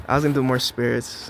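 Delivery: ordinary talk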